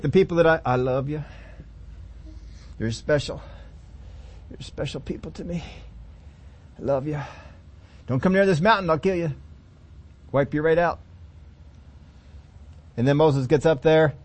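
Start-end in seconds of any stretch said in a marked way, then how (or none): 0.6-11.0 soft and low, a loving warning; tender God voice. Acted. Preacher voicing God to his people, soft and low. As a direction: soothe them even while threatening.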